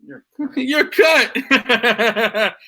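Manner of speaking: laughing. Real, not acted. Host laughing as the guest's audio cuts out. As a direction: crack up while telling him he's cut.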